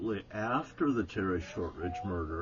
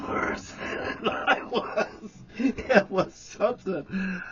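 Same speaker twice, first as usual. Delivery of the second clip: breathy laugh through slow, higher words; 9 semitones higher, 4 dB louder, much slower than usual. Real, not acted. Remembering Jerry laughing, real laughter. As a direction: let the laugh take over the words.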